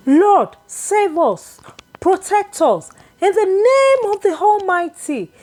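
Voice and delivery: desperate crying prayer rising over chaos; commanding cry. A narrator's reading. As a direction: cry out to God, steady and commanding. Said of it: "she cried".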